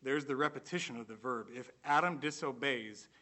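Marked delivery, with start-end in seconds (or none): none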